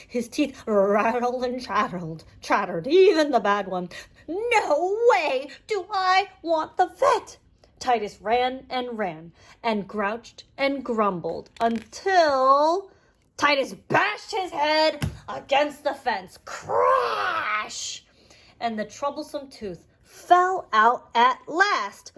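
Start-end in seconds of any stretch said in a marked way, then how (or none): 4.3-7.4 higher, slow stubborn refusal; scared goat. Acted. 16.4-18.0 crash sound effect. Acted.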